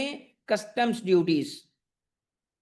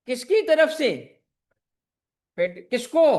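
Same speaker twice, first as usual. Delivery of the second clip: higher and louder question; quizzing the class; 4 semitones higher, 5 dB louder than usual. Real, not acted. (ऊँची आवाज़ में सवाल)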